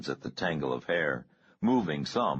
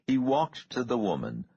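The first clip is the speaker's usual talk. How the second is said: usual speaking voice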